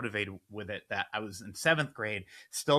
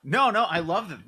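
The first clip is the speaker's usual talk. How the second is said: usual speaking voice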